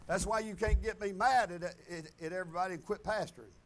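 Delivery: normal talking voice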